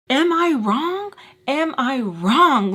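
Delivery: slow, rising pleading; slow indignant plea; pleading question. Retold or imitated. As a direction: drag it out in disbelief. Pleading with brothers.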